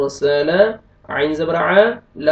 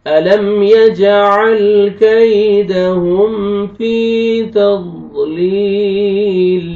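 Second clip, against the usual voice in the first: slow, melodic tajweed chant, louder; slow Quranic recitation; 3 semitones higher, 5 dB louder, much slower than usual. Shown as a demonstration. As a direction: recite the verse in a drawn-out chant. Recites the verse.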